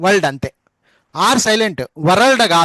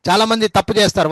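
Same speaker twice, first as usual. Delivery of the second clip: usual speaking voice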